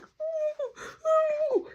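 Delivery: high, muffled whine; imitated high moan; muffled mock whimper.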